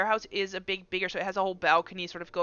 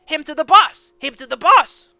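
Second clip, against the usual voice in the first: louder, higher gruff henchman voice; different voice; 6 semitones higher, 12 dB louder than usual. Acted. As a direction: bark the order to the boss. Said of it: "in a different voice says".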